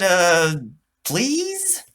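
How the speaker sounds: high, hesitant and polite; sheepish please. A narrator's reading. Boy adds a nervous, polite please to the robot. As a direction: Charlie fears his mother is recording, so he asks sweetly. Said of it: "he swallowed and smiled and said".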